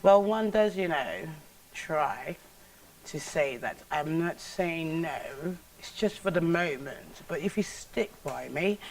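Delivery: posh Queen's English, soft and drawn out; a genteel Queen's English refusal, slowing at the end; Queen's English, softening to a slow teasing maybe. Acted. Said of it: "Now, the Queen's English?".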